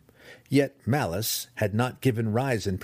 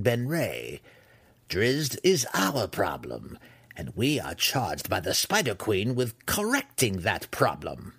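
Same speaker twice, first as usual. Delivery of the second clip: measured, emphatic matriarch; stern matriarch. Acted. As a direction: voice a haughty ruler laying down the facts.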